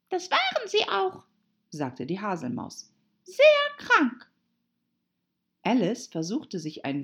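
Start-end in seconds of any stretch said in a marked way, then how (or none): 0.1-1.2 very high, squeaky little voice; reply in a tiny mouse squeak; high-pitched small creature; dormouse. A narrator's reading. Dormouse figure answers. 3.2-4.3 very high, loud squeak; high, emphatic little mouse; dormouse. A narrator's reading. Dormouse figure answers.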